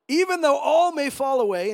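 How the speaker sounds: forceful acted vow; bold declaration. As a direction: declare it boldly, as Peter. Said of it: "Peter's pretty bold".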